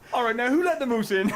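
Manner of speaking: high, loud put-on Canadian voice; Canadian prisoner. Prisoner in the shower spots a moose, high Canadian shout. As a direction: call out in mock indignation. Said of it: "a moose walks in with a prisoner uniform on, like".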